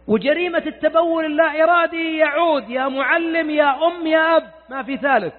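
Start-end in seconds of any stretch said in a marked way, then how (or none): none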